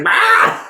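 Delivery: sudden high yelp; mock yelp. Retelling Kikuchiyo's yelp.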